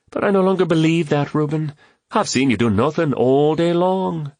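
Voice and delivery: high-pitched playful jab; teasing quip. A narrator's reading. Woman teases a lazy friend, quipping in high pitch. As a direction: pitch it high and needle him. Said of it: "Catherine quipped".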